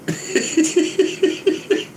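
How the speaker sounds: long high giggle; wheezy, tickled giggling. Real, not acted. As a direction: laugh high and breathless. Laughing at possible gimmick.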